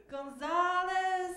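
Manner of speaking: GONZALEZ high, loud and very slowly stretched; over-foreign pronunciation. Acted. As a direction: sing out "Gonzalez" with long, exaggerated foreign vowels. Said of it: "pronounce my first name weird".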